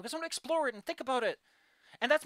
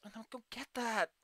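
ordinary delivery

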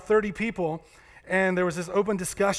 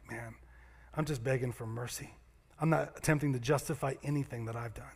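humble begging; 5 semitones lower, 7 dB quieter than usual. Acted. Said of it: "This guy over here was like".

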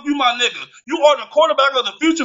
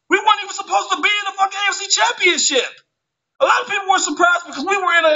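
worked up; 7 semitones higher, faster than usual. Real, not acted. Rant peaks, pitch jumps.

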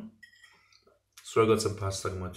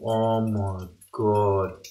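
drawn-out, very slow exclamation; savouring awe; 3 semitones lower, much slower than usual. Real, not acted. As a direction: stretch every word in awe. Reacting to finished dish.

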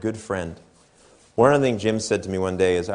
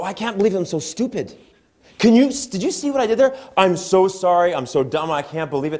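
self-scolding mock; 8 semitones higher than usual. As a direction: ham up beating yourself up after a mistake. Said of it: "we'll go".

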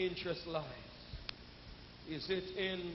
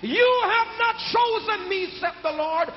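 louder, higher preaching with rhythmic shouted lines; full-force preaching; 8 semitones higher, 12 dB louder than usual. Acted. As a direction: hammer each line out to the congregation.

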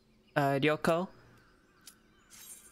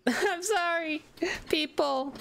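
laughing; 9 semitones higher, slower than usual. Real, not acted. Embarrassed apology to chat.

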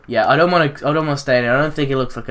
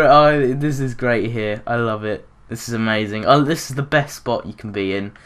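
usual speaking voice

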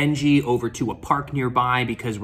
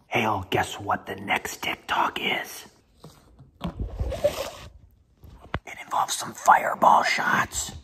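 whispering; 4 semitones lower, 3 dB quieter, slower than usual. Real, not acted. Sneaking a secret TikTok.

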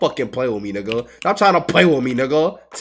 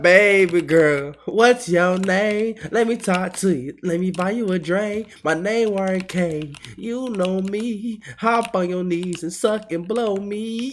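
mock smooth talker, slowed way down; slow smooth pickup line; 3 semitones higher, slower than usual. Retelling his club pickup line in a slow crooning voice. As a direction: stretch it out like a lounge singer. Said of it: "it was like".

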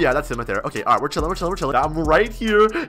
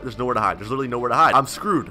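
normal talking voice

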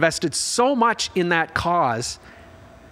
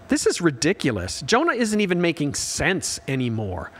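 normal talking voice

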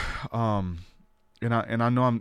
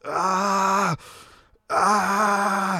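long, high, held "ah" wail; imitated scream; 9 semitones higher than usual. Retelling the pastor buckled over in prayer, imitated scream. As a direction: draw out a high mock scream. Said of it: "he was buckled over screaming".

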